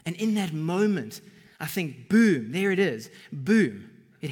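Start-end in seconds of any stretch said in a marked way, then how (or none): 2.1-3.9 loud, punchy boom; emphatic boom. Real, not acted. Preacher driving home the moment Peter is hit, loudly. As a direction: slam the word home.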